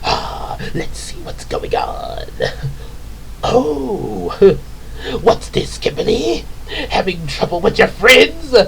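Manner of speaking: scheming villain in airy half-whisper; hushed gloating with wicked chuckles; Rita and Lord Zed. Acted. Villain spying on the heroes' quarrel, gloating. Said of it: "like the way Rita and Lord Zed".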